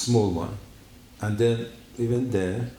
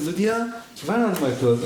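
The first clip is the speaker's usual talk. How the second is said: calling out; 7 semitones higher than usual. Retold or imitated. Calling out for lost clothes.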